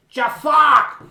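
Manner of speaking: high, loud squawked name; loud comedian impression. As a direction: burst out loud in character. Trying the voice, breaks into loud.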